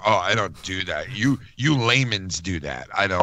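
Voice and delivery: slow, drawn-out superior act; above-it-all mockery. Mocking a guy above all of us, superior. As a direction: imitate someone who thinks he outranks everyone, dragging the words. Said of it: "sound like you're some kinda, like, fucking, you know, above all of us".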